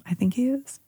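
whisper. Real, not acted. Hushed hopeful aside.

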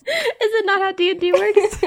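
high-pitched protest through laughter; laughing. Real, not acted. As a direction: laugh as you ask it, voice shooting up. Player laughs while protesting to DM.

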